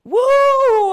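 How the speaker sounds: loud high whoop then a shouted question; loud hyped yell; excited whoop. Real, not acted. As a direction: cheer yourself on after the song. Cheering after singing.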